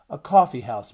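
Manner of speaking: put-on mother firmly correcting the girl; firm correction. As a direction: set her straight with dignity.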